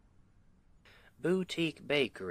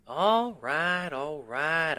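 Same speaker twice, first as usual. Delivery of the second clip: exasperated give-in; 6 semitones higher, 8 dB louder, slower than usual. Acted. Giving in to partner.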